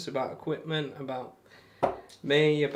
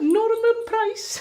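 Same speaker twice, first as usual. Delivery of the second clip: very high, slow, loud Welsh caricature; mock Welsh accent; 8 dB louder, much slower than usual. Streamer taking the mick of Fireman Sam, mock Welsh accent. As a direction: drag the name out in hammed-up Welsh. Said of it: "That's just taking the mick".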